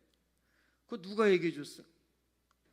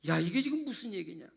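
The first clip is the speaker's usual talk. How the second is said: normal talking voice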